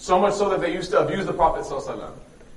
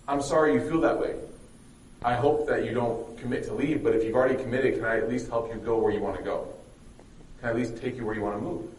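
soft, lower-pitched reassurance; kindly, hushed acted reply; gentle, sincere offer; 5 semitones lower, 6 dB quieter than usual. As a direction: offer help gently and humbly. Retold kind reply to her.

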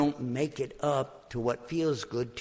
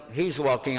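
ordinary delivery

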